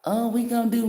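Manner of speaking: high-pitched mock of a tarot reader; mocking other readers. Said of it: "they out here talking about some".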